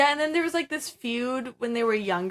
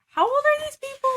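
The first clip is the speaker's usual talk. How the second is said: much higher, put-on disbelieving voice; incredulous; 3 dB louder than usual. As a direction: squeak it in total disbelief. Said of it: "I kept thinking, like".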